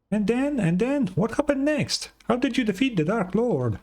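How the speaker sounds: high, bright little companion voice; chirpy high fairy sidekick; Paimon voice. Acted. Paimon voice again.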